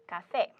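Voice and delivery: café with the stress on FÉ; final-syllable stress. Shown as a demonstration. Teacher giving a counterexample, café stressed on the final FÉ. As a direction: hit the last syllable of café. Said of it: "So counterexample".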